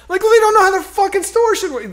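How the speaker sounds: high, loud, indignant outburst; retold angry yelling. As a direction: yell it like an exasperated complaint. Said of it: "you're, like, yelling at these people".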